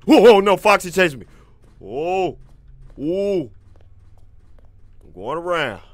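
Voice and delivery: being chased. Real, not acted. Foxy chasing him.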